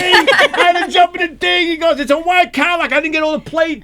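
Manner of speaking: high, slowed frantic complaint; excited complaint. Retold or imitated. Sanitation man telling cops about the Cadillac, high and frantic. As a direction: pitch it high like the shaken witness.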